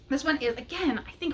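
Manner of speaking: usual speaking voice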